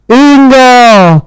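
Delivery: put-on a tiny man calls up to the girl; tiny creature calling. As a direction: summon her from under the chair.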